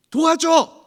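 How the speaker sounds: put-on high, loud little-girl shout; child's cry for help. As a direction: shout like a frustrated toddler. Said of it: "이렇게 얘기를 하는 거예요".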